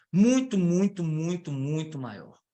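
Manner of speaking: drawn-out stress on each "muito"; "muito" repeated slowly for emphasis; emphatic repetition. Real, not acted. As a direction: stretch every "muito" slowly, starting strong and falling away. Repeats word to emphasise scale.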